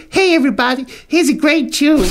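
put-on a kid doing a poor American DJ accent; childish American DJ accent. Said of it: "do this very poor American accent".

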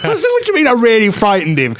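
mock-puzzled comeback to the mate; retold incredulity. Retells reply to his mate.

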